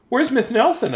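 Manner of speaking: put-on high, loud kids asking; high child voice. Kids asking for their teacher, high child voice. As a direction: voice the puzzled kids. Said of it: "asked the kids".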